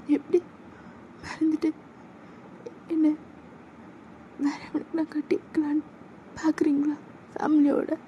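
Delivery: put-on a girlfriend accusing him of forgetting her; hurt young woman.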